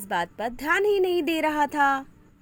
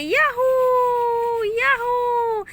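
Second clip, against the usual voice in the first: high, slow, stretched yahoo; dancing fish whoops with joy; joyful cheer; 11 semitones higher, 5 dB louder, much slower than usual. Acted. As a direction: cry out in pure delight. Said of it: "खुशी से नाचने लगती है".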